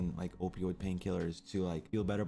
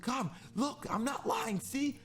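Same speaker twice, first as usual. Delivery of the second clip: high, mock pleading to the nurses; a high, squeaky plea to be believed; slower than usual. Showing the nurse the tag, high mock pleading.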